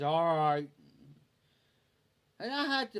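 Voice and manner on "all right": loud put-on voice for the friend; imitating a reluctant friend; grudging yes. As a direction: bark a reluctant okay.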